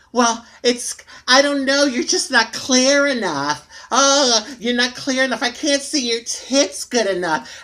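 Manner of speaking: high, loud whiny mock complaint; mocking critics. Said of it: "people saying".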